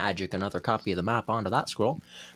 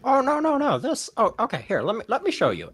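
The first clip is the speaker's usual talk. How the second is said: higher-pitched, fussy game character voice; eager guide, higher voice; flustered helpful guide character; 12 semitones higher, 3 dB louder than usual. Acted. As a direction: hurry in to correct them, pitched up. Game master plays a character.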